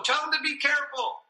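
high, sustained call relayed to the room; joking background relatives. Retold or imitated. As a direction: imitate a relative calling the news across the room. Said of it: "they were always joking and in the background".

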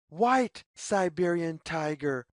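plain reading voice

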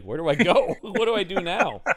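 mock helpless bewilderment; Michael Moore. Host as the film-maker left in a stranger's house, mock bewildered. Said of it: "Michael Moore is just like".

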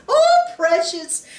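high, slow, doting grandma coo; granny cooing. Retold or imitated. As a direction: coo it like a cheek-pinching granny. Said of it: "she called you precious".

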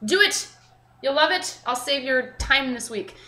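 usual speaking voice

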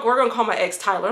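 normal talking voice

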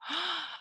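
surprised gasp. Real, not acted. Praising child's clear word.

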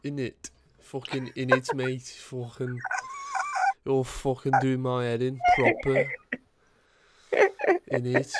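mock London geezer accent, innit; British accent. Gamer mocks a British accent on request, cheeky Cockney. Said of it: "can you say in it".

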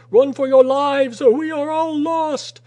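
very high, loud desperate yell; alarmed shout. A narrator's reading. Saunders urging men to flee the flood, desperate shout. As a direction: scream at them to flee. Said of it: "Saunders shouted".

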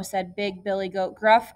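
plain reading voice

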